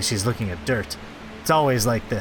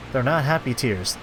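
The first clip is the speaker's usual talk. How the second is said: usual speaking voice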